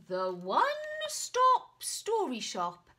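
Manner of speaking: loud, high and slow; excited reading. Acted. Knight reading the shop sign, excited and loud. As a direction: announce the sign with wonder.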